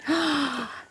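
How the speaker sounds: sharp gasp. Acted. Sophie trips.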